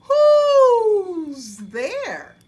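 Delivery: high, loud hooting call into the dark; a wary owl calling out from its stump. Acted. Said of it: "he was startled by a voice".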